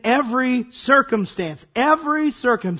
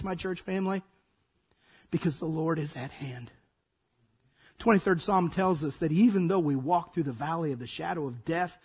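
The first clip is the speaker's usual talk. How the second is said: softer, lower, gentle; tender comfort; 6 semitones lower, 8 dB quieter than usual. Real, not acted. Pastor consoling his church family, soft and low. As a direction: comfort them quietly, as in a psalm.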